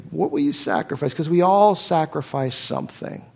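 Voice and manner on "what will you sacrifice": normal talking voice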